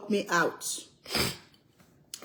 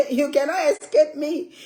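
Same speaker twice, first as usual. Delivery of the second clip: playful sinister mom, a bit higher; teasing the daughter who's stuck in the car, gleeful mock menace; mock villain; 5 semitones higher, 4 dB louder than usual. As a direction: gloat like a cartoon villain.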